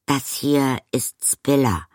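high, slow, tentative introduction; slightly unsure. A narrator's reading. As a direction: introduce someone a little uncertainly. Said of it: "fuhr Arrietty etwas unsicher fort".

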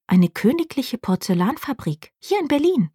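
curious question rising high at the end. A narrator's reading. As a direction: wonder at a royal porcelain factory. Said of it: "Neugierig fragt Lilly".

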